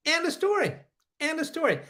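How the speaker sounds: high, clipped-off declaration, case closed; emphatic finality. Real, not acted. Streamer defending his claim, insisting with high emphatic finality. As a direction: slam the point shut.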